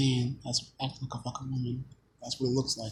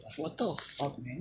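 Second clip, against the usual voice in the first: ordinary delivery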